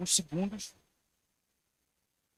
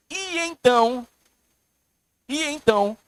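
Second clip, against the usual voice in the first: e então high and loud; loud emphasis; 6 semitones higher, 9 dB louder, slower than usual. Acted. Preacher stressing e então to the congregation, high and loud. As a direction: ring out e então.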